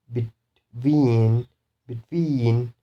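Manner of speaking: be-TWEEN, long stressed second syllable in between. Shown as a demonstration. Teacher shows the second-syllable rule, stress on TWEEN in between. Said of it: "stress will be on second syllable".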